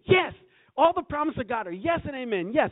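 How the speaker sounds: loud, high, exultant declaration; excited affirmation. Acted. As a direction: shout it out like a fired-up believer. Acts out own excited affirmations.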